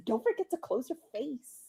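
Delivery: softly delivered deadpan comeback; imitating own quiet wisecrack; deadpan joke. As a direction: say it soft, as a throwaway joke. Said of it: "I said it just like this".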